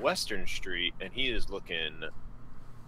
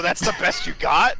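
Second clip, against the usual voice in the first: taunt; 8 semitones higher, 5 dB louder than usual. Acted. Taunting the other character.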